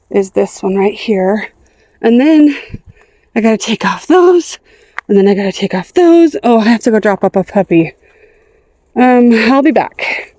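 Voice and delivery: normal talking voice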